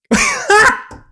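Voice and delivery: high, loud squealing laugh. Real, not acted. Player laughing at the snoop getting caught, loud and high. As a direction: shriek with delight at the drama.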